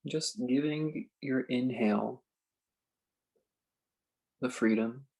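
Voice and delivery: slow, spacious meditation guide with long pauses; calm phrases left hanging in silence; guided meditation. Acted. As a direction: ease them into stillness, unhurried.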